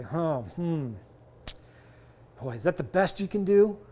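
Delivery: a touch of mock disappointment, sighing hmm; sound disappointed. Shown as a demonstration. Investor nudging a seller on price, mildly disappointed. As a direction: let the seller hear you're a little let down by the number. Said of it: "Oh, I sound a little disappointed".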